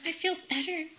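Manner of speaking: high, soft, emotional voice; re-enacted tender, small-voiced news; hushed relief. Breaking happy news to dad.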